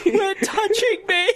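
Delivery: very high, hammed-up wail; mock scream. Faking an assault as you drop to the ground, mock scream. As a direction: squeal it through laughter, faking distress. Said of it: "drop to the ground and scream".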